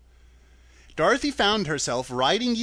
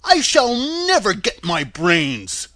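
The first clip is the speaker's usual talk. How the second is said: loud, slow Scarecrow voice starting high; loud, drawn-out complaint; Scarecrow's lament; 5 semitones higher, 8 dB louder, slower than usual. Acted. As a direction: wail it slowly as the Scarecrow.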